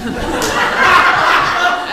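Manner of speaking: airy burst of high laughter; breathy high giggle. Real, not acted. Laughs at her own admission.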